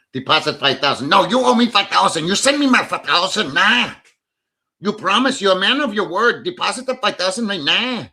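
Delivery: hammed-up demanding scammer voice; mock demanding scammer. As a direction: play the insistent girlfriend wanting her money now. Mocking scammer demanding money.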